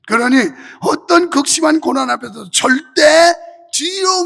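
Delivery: loud, forceful sermon delivery; preaching. Real, not acted. Pastor urging congregation to endure through faith, forceful preaching. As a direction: preach it with driving conviction.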